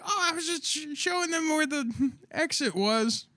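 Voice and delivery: high innocent excuse; mock innocent. Excusing self to guard, high mock innocence.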